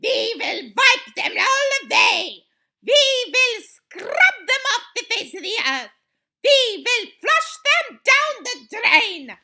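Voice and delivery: loud, high screaming witch tirade; screamed. A narrator's reading. Grand High Witch rallying her witches, screamed. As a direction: scream each vow to the crowd. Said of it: "screamed the Grand High Witch".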